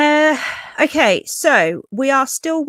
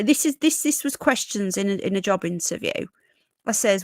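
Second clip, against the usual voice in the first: ordinary talk, nothing marked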